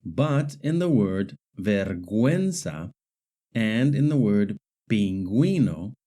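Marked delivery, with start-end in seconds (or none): 1.6-2.9 vergüenza with the ü sounded, "gwen"; pronounced U. Shown as a demonstration. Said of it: "the U is pronounced". 4.9-5.9 pingüino with the ü sounded, "gwi"; pronounced U. Shown as a demonstration. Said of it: "the U is pronounced".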